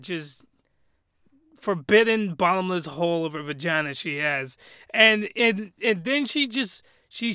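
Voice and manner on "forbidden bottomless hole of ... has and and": usual speaking voice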